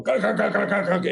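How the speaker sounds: high chattering rotor noise; imitated helicopter. Skid hovering inches from climber, high imitated rotor chatter. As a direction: mimic hovering blades with rapid syllables. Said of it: "skid just kinda going".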